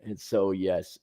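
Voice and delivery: ordinary delivery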